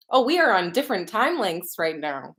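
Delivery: ordinary delivery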